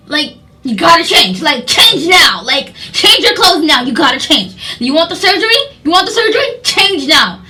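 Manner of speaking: mock a pushy staffer demanding compliance; bossy lady. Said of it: "the lady was like".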